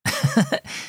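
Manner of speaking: short, high chuckle; light laugh at a silly suggestion; acted boy's laugh. A narrator's reading. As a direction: give a brief, high giggle as the boy. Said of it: "Bruno laughed".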